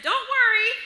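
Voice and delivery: high-voiced reassurance. Reassuring a woman in the restroom, high and loud. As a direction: pitch it way up to prove you're a woman.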